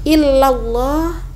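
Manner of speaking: illallah drawn out slowly; slow careful recitation. Shown as a demonstration. Teacher recites illallah slowly for students. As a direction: stretch out illallah. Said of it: "Hindari yang salah tadi".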